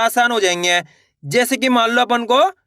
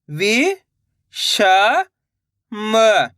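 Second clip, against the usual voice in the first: "वि", "श", "म" each said alone; syllable by syllable; much slower than usual. Shown as a demonstration. Teacher sounding out for beginners, syllable by syllable. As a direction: leave clear pauses in "वि … श … म". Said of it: "direct मात्रा का उच्चारण करके".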